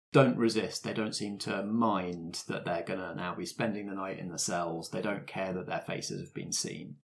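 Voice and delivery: usual speaking voice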